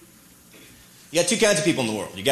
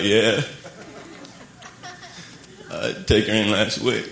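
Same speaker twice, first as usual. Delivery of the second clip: put-on low, worried Texas accent; Texas guy; 5 semitones lower than usual. Texas man tells buddy about broken truck, low Texas accent. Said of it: "Dude's like".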